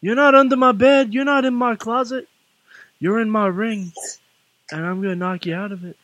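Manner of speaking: put-on high-pitched wrestling promo with a Boston accent; Boston-tinged wrestling promo. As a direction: cut a challenger's promo, Boston accent creeping in. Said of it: "I think you were using your Boston accent again".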